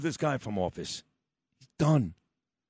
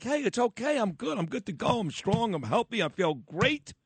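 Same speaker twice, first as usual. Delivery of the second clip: mock upbeat Biden; 7 semitones higher than usual. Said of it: "make you feel like".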